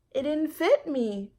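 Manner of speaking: put-on high, slow child's voice; a child making an excuse; young Jamaica, a little defensive; little girl voice. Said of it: "Jamaica said".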